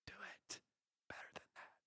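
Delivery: very soft, breath-only whisper; whispered urging. Acted. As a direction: whisper it as a private nudge.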